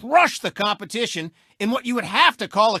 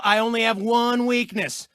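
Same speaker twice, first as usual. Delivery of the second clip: ordinary talk, nothing marked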